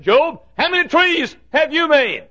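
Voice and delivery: high, booming question to Job; God taunting Job from above; God's challenge. Acted. God voiced asking Job.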